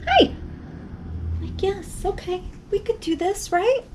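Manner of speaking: high, soft, unsure; hesitant inner voice. Retold or imitated. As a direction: talk yourself into it. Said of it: "I'm thinking, like".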